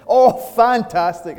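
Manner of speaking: slow, high, loud acted delight; gushing enthusiasm. Customer answering bank teller's weekend question, hammed-up gushing.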